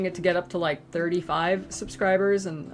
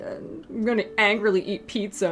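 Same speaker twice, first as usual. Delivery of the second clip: slow, sad, ends in a whisper; sulking to friends, trailing to a whisper; sulky whine; slower than usual. Retold or imitated. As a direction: pout and mope. Sulking reply.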